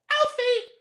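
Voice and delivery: playfully calling his name like the woman in the car; woman calling Alfie. Retold or imitated. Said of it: "the woman in the car goes".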